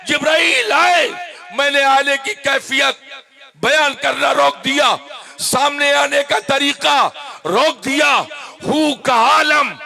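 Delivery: shouted, high sermon climax; preaching at full force. Acted. Preacher dramatizing the revelation to listeners, shouted at full force. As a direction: thunder it out to the congregation.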